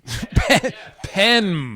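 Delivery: Pen said with a short clipped e, no N sound; careful pronunciation. Shown as a demonstration. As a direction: say Pen as one crisp syllable. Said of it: "It's a silent N".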